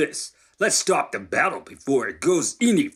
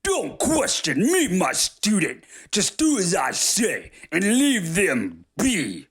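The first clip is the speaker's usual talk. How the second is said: high, slow, drawn-out overlord's scolding; affronted exclamation; 8 semitones higher, 3 dB louder, slower than usual. A narrator's reading. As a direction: scold a disrespectful student imperiously. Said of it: "feeling disrespected, exclaims".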